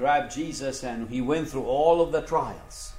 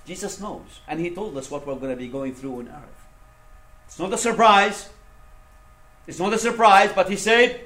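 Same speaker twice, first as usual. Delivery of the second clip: ordinary delivery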